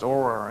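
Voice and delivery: R drawn out, low, with a heavy r; mock the rolling R; R held long with the r sounded hard. Shown as a demonstration. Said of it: "You got the rolling R".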